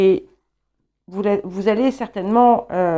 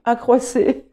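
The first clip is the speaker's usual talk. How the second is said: accroissez said firm and louder, settled; confirmed; 3 semitones higher, 5 dB louder, slower than usual. Shown as a demonstration. Card reader confirming the verb form to viewers, assured. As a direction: land accroissez with relief and certainty.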